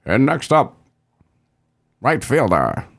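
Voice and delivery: put-on ballpark announcer; Vin Scully. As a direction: announce the batter over the stadium. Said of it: "voice of the Dodgers".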